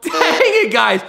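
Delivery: high loud exasperated cry; frustrated shout. Real, not acted. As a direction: yell it out, fed up. Remedy failed again.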